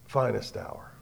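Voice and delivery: soft whispered refrain; preacher whispering the refrain to the crowd. Acted. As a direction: let the phrase fall to a whisper. Sermon's closing refrain.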